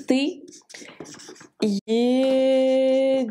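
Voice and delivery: едешь in long, level, held syllables; sounding out slowly. Shown as a demonstration. Teacher showing how tu vas is written, slow sounding-out. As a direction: stretch every syllable of едешь.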